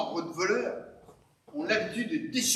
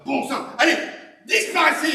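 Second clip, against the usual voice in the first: raised, exasperated order to leave; furious shout; 5 semitones higher, 4 dB louder than usual. Acted. Homeowner orders the dog owner away, furious shout. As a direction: shout the intruder off your property.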